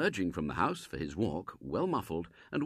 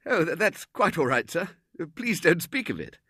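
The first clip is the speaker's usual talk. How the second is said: higher, louder, stammering politeness; flustered young Robert; 9 semitones higher, 6 dB louder than usual. Acted. Robert waving off the Colonel's apology, flustered and high. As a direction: brush it off awkwardly.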